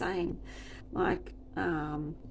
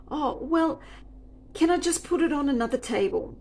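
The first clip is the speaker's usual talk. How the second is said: higher, louder, quick pleading; whiny customer; 8 semitones higher, 4 dB louder than usual. Retold or imitated. Customer begging to move dishes, high and whiny. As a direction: wheedle like the pushy customer. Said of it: "she goes".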